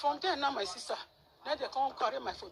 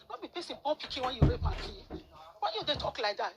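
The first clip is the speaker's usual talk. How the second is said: indignant scolding; 4 semitones higher, 6 dB louder than usual. Retold or imitated. Scolding the boy's mother.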